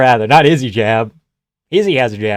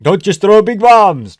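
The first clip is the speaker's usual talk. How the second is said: higher, louder, slow coaching call; mock cornerman; 7 semitones higher, 7 dB louder, slower than usual. Coach advice continued.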